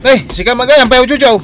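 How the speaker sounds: loud, high call across the yard; shouted greeting. Acted. Waldo hails his brother-in-law from a distance, shouting. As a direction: yell a cheerful hello from far off.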